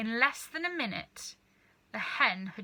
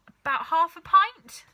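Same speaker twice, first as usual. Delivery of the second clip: put-on high, louder boy voice; a lad piping up; boy's answer; 8 semitones higher, 11 dB louder than usual. A narrator's reading. George answering his dad, high loud boy voice.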